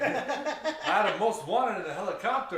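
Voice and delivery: a high, laughing impression of an angry gamer; hammed-up angry gamer voice; mock rage rant. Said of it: "in that accent".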